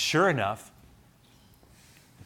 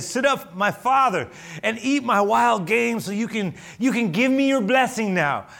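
eager Esau; 9 semitones higher, 6 dB louder than usual. Acted. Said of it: "And then he said".

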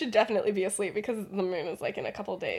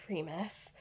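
very soft, lower voice; hushed address; 4 semitones lower, 15 dB quieter than usual. Retold or imitated. Shaken Lily turning to Remus, hushed. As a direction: say the name almost under your breath.

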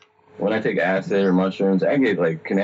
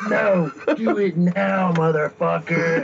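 high, slow mock devil command; hammed-up devil drawing out each word; big devil voice; 9 semitones higher, slower than usual. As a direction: play the bossy devil, pitch way up, through amusement. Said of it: "hearing this big devil voice".

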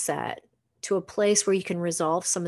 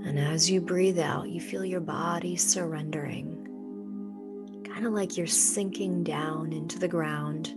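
soft spa whisper; 5 dB quieter than usual. Acted. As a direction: ease them into calm, unhurried. Leads a guided meditation.

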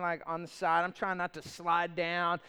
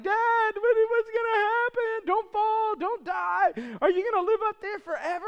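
put-on high, quick, panicky child voice; panicky kids; 11 semitones higher, 3 dB louder than usual. Kids worried their dad is stuck on the roof, high and panicky. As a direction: squeak it out like a scared little kid.

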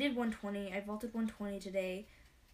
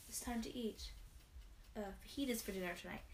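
ordinary delivery

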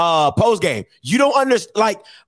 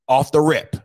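ordinary talk, nothing marked

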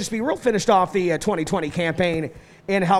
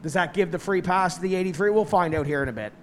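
ordinary talk

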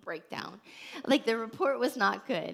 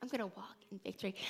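faster, much softer repeat of I'm gonna walk in victory; quick hushed repeat; 10 dB quieter, faster than usual. Shown as a demonstration. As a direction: rush it under the breath. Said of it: "you're saying".

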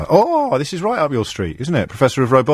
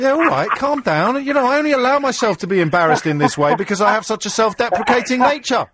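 playful fake indignation; mock indignant; 10 semitones higher than usual. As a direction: pretend to be offended, pitched up. Host mock-defends after being teased.

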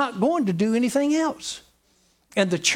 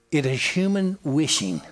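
normal talking voice

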